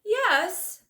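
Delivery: high, loud bear's yes; eager bear. Acted. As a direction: blurt it out like a big eager bear. Bear character voice.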